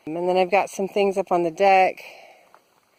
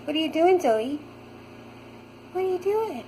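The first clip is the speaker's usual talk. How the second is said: cooing at the cat; 10 semitones higher than usual. Real, not acted. Talking to her cat Zoe, high cooing baby talk.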